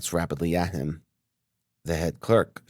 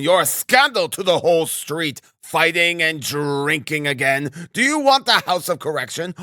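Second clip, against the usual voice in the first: high, hectoring tirade; angry berating; 9 semitones higher than usual. A narrator's reading. Assistant superintendent berating the landlady, furious. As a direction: scold her like an outraged official.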